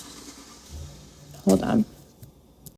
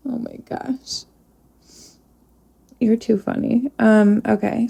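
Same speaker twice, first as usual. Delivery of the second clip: ordinary delivery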